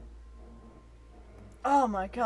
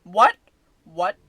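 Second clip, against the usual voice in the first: ordinary delivery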